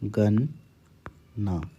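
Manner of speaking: गन्ना drawn out very slowly and softly; stretched गन्ना; slow dictation. Shown as a demonstration. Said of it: "अगला शब्द है गन्ना".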